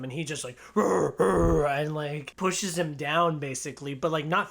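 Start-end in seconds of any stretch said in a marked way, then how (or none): 0.7-1.7 loud rolled mock growl; two short animal growls, imitated; imitated barking growl. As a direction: growl back like a barking dog. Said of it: "barking at him".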